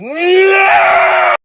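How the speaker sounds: high shrill scream of terror; screeching. Retold or imitated. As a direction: scream like you're about to be hit. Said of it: "was me screeching".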